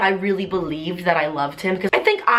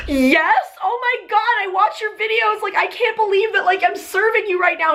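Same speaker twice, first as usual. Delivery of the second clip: much higher, gushing excited fan voice; starstruck fan; 12 semitones higher than usual. Retold or imitated. Waiter gushing to the YouTuber he recognizes, high and excited. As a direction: play the waiter who just realized who he's serving.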